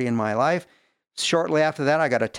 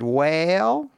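drawn-out Southern "Well", pitched up; imitated Tennessee accent; 4 semitones higher than usual. Woman hesitating at his invitation, imitated in a Tennessee accent. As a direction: stretch it into a Tennessee drawl. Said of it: "your accent".

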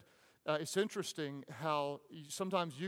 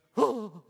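sharp gasp; 6 semitones higher, 11 dB louder than usual. Retold or imitated. Retelling failing to sit still.

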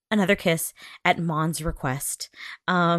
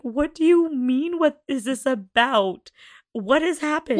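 confused disbelief; 7 semitones higher, 4 dB louder than usual. Retold or imitated. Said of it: "I was like".

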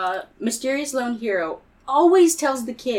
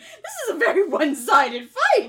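panicked; 5 semitones higher than usual. Acted. Acted panic, laughing.

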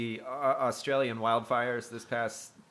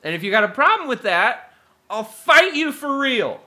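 mock threat; 12 semitones higher, 10 dB louder than usual.

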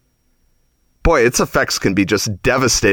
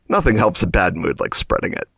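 mean smile; 6 semitones lower, 4 dB quieter than usual. Retold or imitated. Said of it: "mean smile on his face and he's like".

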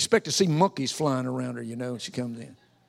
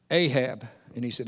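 usual speaking voice

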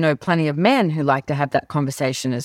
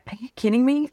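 disbelief; 9 semitones higher, faster than usual. Retold or imitated. Disbelief at Gary's claim.

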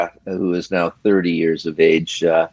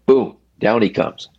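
ordinary talk, nothing marked

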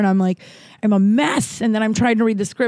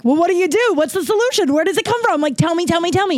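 eager; 10 semitones higher, faster than usual. Retold or imitated. Eager inner plea to speaker.

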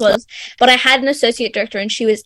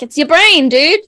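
higher, louder mock exasperation; exasperated appeal; 5 semitones higher, 5 dB louder, slower than usual. Young director to people who skip helmets, exasperated appeal. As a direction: drive it home to a careless friend, pitch lifted.

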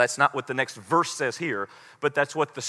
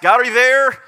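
higher, louder earnest prayer; pleading prayer; 5 semitones higher, 5 dB louder than usual. Retold or imitated. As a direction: beg God to be there. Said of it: "I always ask".

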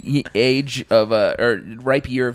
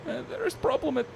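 mock Russian accent; 12 semitones higher, 9 dB quieter than usual. Russian agent phone call.

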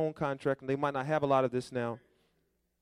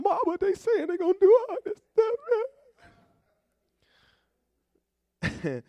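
high, excited squeal to mom; excited call to mom; 3 dB louder than usual. Pretend. Preacher calling his mom, thrilled and squealing. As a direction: pitch way up like a thrilled kid on the phone.

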